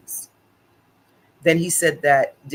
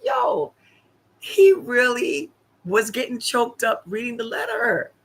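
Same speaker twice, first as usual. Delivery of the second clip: excited insistence; 8 semitones higher than usual. Retold or imitated. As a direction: squeal it to convince him. Insisting to the doubting neighbour.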